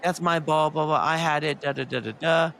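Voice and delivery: high, whiny mock of an angry woman; mocking Karen voice. Mocking the woman who grabbed the ball, high and whiny. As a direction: mimic her high and indignant. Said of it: "And she's like".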